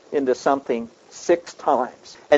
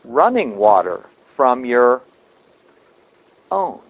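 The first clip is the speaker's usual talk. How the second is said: slow, running stressed, long pause before own; slowed for emphasis; 3 semitones lower than usual. Shown as a demonstration. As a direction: drag it out, stress running, then drop own. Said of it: "Let me slow it down for you just so you catch it".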